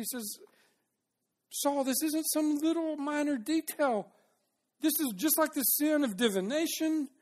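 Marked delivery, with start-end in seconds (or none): 1.5-4.1 high-pitched, insistent correction at normal volume; urgent admonishing. Acted. Samuel telling Saul it is no detail, urgent admonishing. 4.8-7.1 high-pitched insistence on how serious it is; urgent admonishing. Acted. Samuel correcting Saul on his sin, urgent admonishing. Verse restated emphatically in paraphrase.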